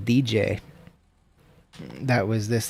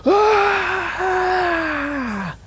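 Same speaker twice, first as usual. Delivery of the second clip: imitated wordless scream; 3 dB louder than usual. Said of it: "He just went".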